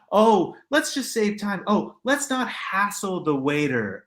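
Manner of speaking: mocking a friend who wants to split; mock sweet excuse. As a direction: parody the friend's fake excuse.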